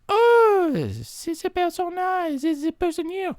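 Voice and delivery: find the hummer; hammed-up high wonder; high discovery. Curious stranger discovers hummer.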